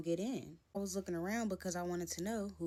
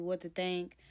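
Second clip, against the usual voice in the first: usual speaking voice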